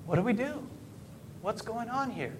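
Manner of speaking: acted bewilderment. Preacher voicing apostles blocked at every turn, acted bewilderment. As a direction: ask softly and high, as if at a loss.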